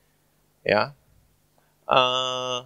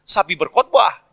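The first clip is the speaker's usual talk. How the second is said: loud, raised Papuan mimicry; contracted Papuan speech; 6 semitones higher, 7 dB louder, slower than usual. Shown as a demonstration. Preacher answering as a Papuan, loud imitated accent. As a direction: answer like a Papuan, "saya pergi" squeezed into "sapi". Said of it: "Cepet-cepet orang Papua".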